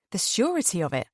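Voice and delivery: usual speaking voice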